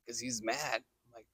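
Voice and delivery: ordinary delivery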